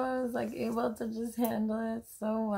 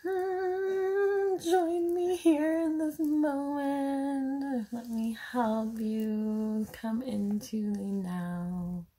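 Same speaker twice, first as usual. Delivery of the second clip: guided meditation; 4 semitones higher, slower than usual. Acted. Mom inviting viewers into the now, as a slow guided meditation. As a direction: guide the listener gently into the moment.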